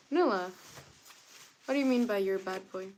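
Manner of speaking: usual speaking voice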